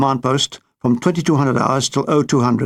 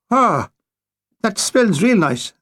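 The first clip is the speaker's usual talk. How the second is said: slow, high, savouring sigh; drawn-out delight over the whiskey; 5 semitones higher, slower than usual. Acted. Priest sniffs whiskey.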